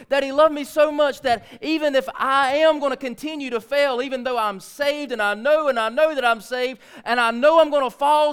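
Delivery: loud, rising sermon climax; preaching at full force. Acted. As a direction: build the congregation to a fervent peak.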